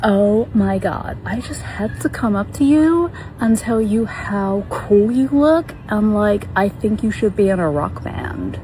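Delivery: drawn-out, admiring mimicry; gushing American woman. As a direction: imitate an American stranger gushing. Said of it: "this woman comes up to us and she just goes".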